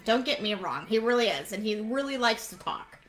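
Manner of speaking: ordinary talk, nothing marked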